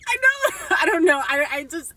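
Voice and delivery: high, loud, giggly outburst; tickled and embarrassed, pitch leaping up. Real, not acted. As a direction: squeal it through a laugh. Giggling over a cute memory.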